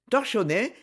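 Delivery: ordinary delivery, nothing marked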